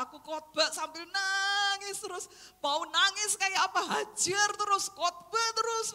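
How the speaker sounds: loud, fervent preaching push; fervent shout. Real, not acted. Preacher to her congregation, shouting with fervour. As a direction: drive it home at full voice.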